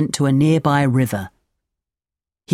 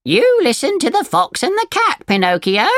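high, swooping bird voice; storybook parrot telling someone off; scolding parrot; 13 semitones higher than usual. A narrator's reading. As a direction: lecture as a high-pitched parrot.

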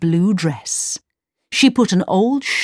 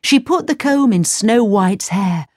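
usual speaking voice

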